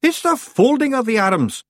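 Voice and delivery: high, loud correction on "folding"; snapped correction. A narrator's reading. Rob correcting Daft Willie's muddled phrase, snapped. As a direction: snap it back, punching "folding". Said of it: "snapped Rob Anybody".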